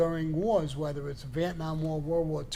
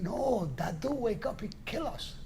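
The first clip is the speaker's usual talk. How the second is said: put-on higher, hushed whisper with a French accent; 6 semitones higher, 4 dB quieter than usual. Ani warning him about Dadu, hushed French-accented whisper. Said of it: "She said".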